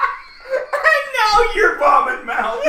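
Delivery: laughing shout. Real, not acted. Teasing shout amid group laughter.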